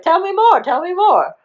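high, loud excited begging; hammed-up, squealing eagerness; overjoyed eagerness. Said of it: "he was so happy".

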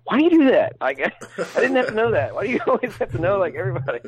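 high-pitched mock exasperation, breaking into laughter; annoyed wife imitation. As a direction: imitate a wife fed up with the habit. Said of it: "And she'd be like".

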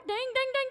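high sing-song ding imitation; car chime imitation. Preacher mimicking the door chime, high and sing-song. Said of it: "imitating car door chime".

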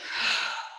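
long audible exhale; breath out. Shown as a demonstration. Guide leading listeners in a full breath, long exhale. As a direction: sigh the breath out fully. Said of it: "take a full breath in".

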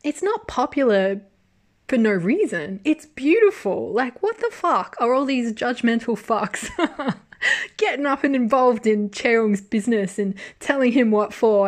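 loud, high, indignant outburst; furious rant. Retold or imitated. A fan ranting at the judgmental critics, loud and furious. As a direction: let the outrage pour out at the critics. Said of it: "And I'm like".

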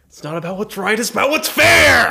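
rising to a loud shout; Harvey Dent impression. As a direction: build to an angry yell. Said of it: "Everyone do an impression".

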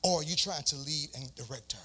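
low, soft, near-whispered question; quiet, low counter-question to the husbands; hushed question. Real, not acted. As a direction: drop low and soft, offering the gentler option. Gentle alternative after a challenge.

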